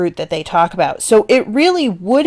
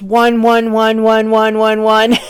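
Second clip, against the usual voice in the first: each "one" tapped out evenly; rhythmic listing demonstration; repeated one; 3 semitones higher than usual. Shown as a demonstration. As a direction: count out the repeated "one" like a list. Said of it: "here's the list".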